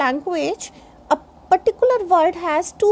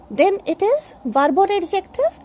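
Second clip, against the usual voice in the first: usual speaking voice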